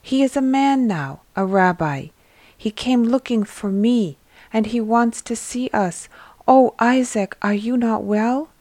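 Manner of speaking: tumbling out the news with joy; excited friend, then sudden worry. Acted. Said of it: "You are excited".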